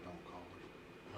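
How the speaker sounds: hushed. Real, not acted. Hushed aside off the show.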